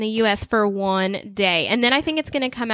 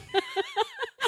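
high squeal of laughter; 9 dB quieter than usual. Real, not acted. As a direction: burst of high giggling. Laughing at own story.

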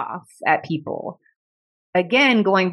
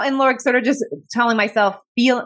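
ordinary delivery

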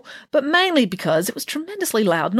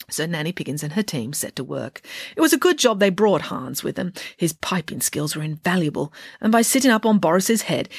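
plain reading voice

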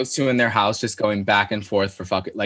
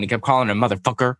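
ordinary delivery

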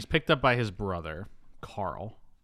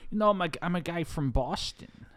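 put-on higher, half-hearted Boston accent; 7 semitones higher than usual. Host attempting Travolta's movie accent, shaky-aimed Boston try. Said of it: "I can't even do his accent".

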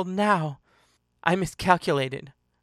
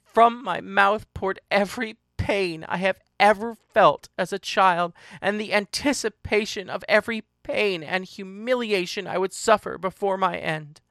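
ordinary delivery, nothing marked

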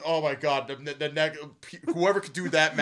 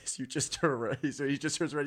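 ordinary delivery

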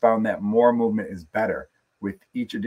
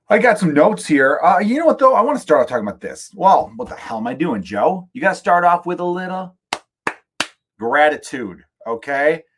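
loud self-pep talk; 7 semitones higher, 8 dB louder than usual. Real, not acted. Self-address mock pep talk to Joe.

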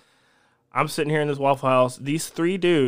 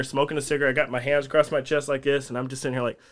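ordinary talk, nothing marked

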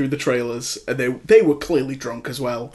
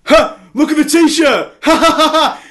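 mocking a loud, laughing heckler at a much higher pitch; drunk guy shouting; 12 semitones higher, 6 dB louder than usual. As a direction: bellow it out and guffaw like a drunk in the cinema. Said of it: "he'd sit there and shout".

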